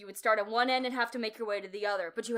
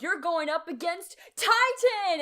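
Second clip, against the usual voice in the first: slow, drawn-out announcer call; a hint of a hyped arena announcer; 7 semitones higher, 4 dB louder, slower than usual. Imitating the announcer.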